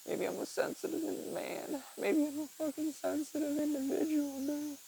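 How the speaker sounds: high, soft, mocking sensitive-man voice. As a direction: put on a breathy high mocking tone.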